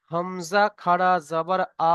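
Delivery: slow spelling-out, with the final "আ" held long; drawn-out vowel. Shown as a demonstration. Teacher shows students the one-alif stretch, final "আ" drawn out. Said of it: "এক আলিফ পরিমাণ টান দিয়ে পড়তে হবে".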